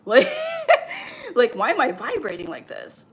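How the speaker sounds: laughing in disbelief. Retold or imitated. Overwhelmed by vibrating energy.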